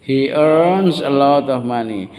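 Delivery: slow, drawn-out teacher's model sentence; repeat-after-me model. Shown as a demonstration. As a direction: stretch each word for the class to repeat.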